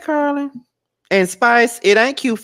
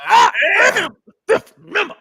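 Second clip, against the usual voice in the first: pitched-up, hollow declaring voice; deadpan diva proclamation; 10 semitones higher, 4 dB louder than usual. Shown as a demonstration. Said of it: "You gotta hit that dead voice".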